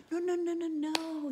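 high loud squeaky cries of no; pleading little goat. Acted. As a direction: squeal it out like a frightened kid goat. Little goat begs troll.

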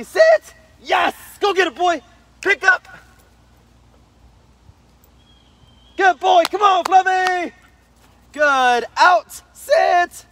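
high-pitched praise to a dog fetching; excited dog praise. Real, not acted. Praising dog during fetch demo.

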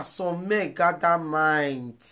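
slow emphatic. Real, not acted. Slow loud emphatic repeat.

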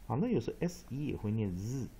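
usual speaking voice

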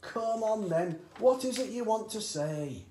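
high, loud, pressing; impatient dad. A narrator's reading. Father pressing his son to speak, high and loud. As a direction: hurry the boy to speak.

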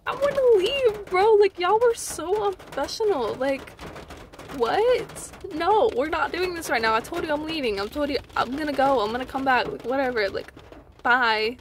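high, loud retold rant, easing off; exasperated outburst. Patient telling off clinic staff, exasperated. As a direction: burst out in fed-up protest, then settle.

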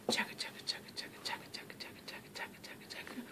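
whispered chugga chugga train; breathy train chugging sounds; chugging toy train voice. Pretend. Parents feeding baby, train game.

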